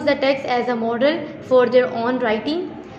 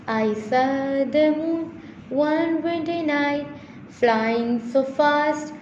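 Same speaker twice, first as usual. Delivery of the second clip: slow sing-song verse with long drawn-out words; poem recitation; 2 semitones higher, slower than usual. Shown as a demonstration. Teacher reciting the poem Moon to students, slow sing-song recitation. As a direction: chant the poem line by line, stretching the vowels.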